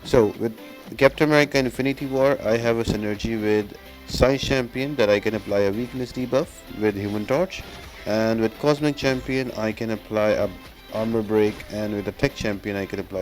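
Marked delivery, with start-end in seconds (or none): none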